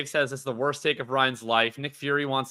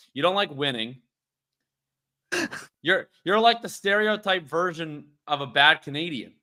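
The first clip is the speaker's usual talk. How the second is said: usual speaking voice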